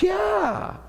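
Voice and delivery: a high, pitying confirmation; imitating the marketing lady.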